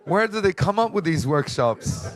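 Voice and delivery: high, loud incredulous complaint; comic exasperation. Real, not acted. Speaker griping to audience about workshops, mock exasperation. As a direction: throw it out loud, inviting a laugh.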